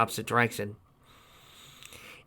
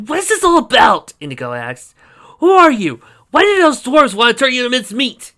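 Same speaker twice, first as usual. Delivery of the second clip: high, loud interrogation; demanding questions; 12 semitones higher, 11 dB louder than usual. A narrator's reading. Warrior grilling stranger, loud and demanding. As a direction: press him hard for answers.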